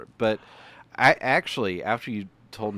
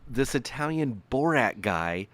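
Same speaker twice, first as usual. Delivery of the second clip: mock baffled TV host; 7 semitones higher than usual. Mocking Today Show hosts on air.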